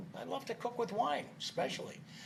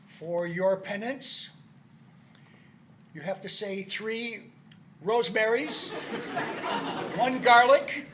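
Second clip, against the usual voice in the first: loud blessing, breaking into laughter; priestly pronouncement through giggles; laughing; 7 dB louder than usual. Acted. Pope gives joking penance.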